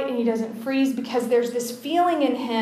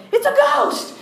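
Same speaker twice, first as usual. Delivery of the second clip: high, squeaky mock panic; comic shriek of terror; freaking out; 5 dB louder than usual. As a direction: shriek it like a panicked man, played for laughs. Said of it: "and they freak".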